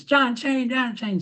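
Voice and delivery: high mock heckle from the front row; imitating the mother's high chant; heckling mom. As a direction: call his name out like a mom in the crowd. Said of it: "and she keeps".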